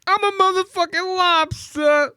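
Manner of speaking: very high, squeaky mock tough-guy rap line; mock rapper boast. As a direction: squeal it out like a wannabe rapper freestyling. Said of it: "doing it like a rapper".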